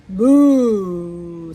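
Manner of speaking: long loud cow moo; imitated mooing. A narrator's reading. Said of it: "said Cow".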